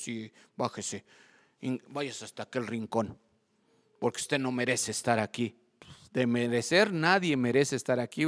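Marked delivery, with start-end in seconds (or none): 0.0-5.6 lower, measured mock-disapproving minister; a hint of a severe churchman dismissing someone; lightly voiced stern minister. Minister sending a brother to the corner, mildly stern. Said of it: "no faltan los ministros".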